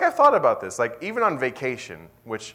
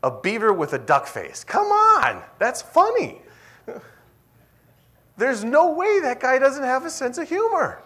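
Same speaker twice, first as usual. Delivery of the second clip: much higher, louder delighted exclaim; excited shout; 11 semitones higher, 6 dB louder than usual. Acted. Preacher shouting the platypus joke to the congregation, excited. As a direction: crack up the room with it.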